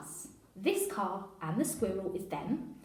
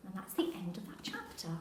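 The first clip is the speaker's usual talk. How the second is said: whispered; 7 dB quieter than usual. Real, not acted. Closing the chapter softly.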